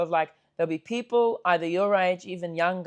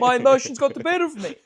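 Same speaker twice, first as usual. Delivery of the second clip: higher, louder mock-sheepish apology; hammed-up apologetic stranger; 6 semitones higher, 6 dB louder than usual. Stranger apologizes to the lads, hammed-up and higher. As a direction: overdo the sorry like a reformed tough guy.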